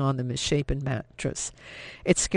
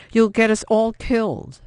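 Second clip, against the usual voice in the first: furious; 7 semitones higher, 6 dB louder than usual. A narrator's reading. Furious at reckless sister.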